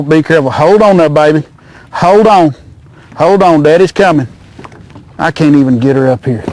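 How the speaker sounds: ordinary talk, nothing marked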